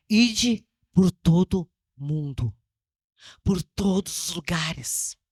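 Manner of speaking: slow, low, weighty proclamation; solemn scripture quote. Acted. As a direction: intone the command like a decree. Quoting the great commission.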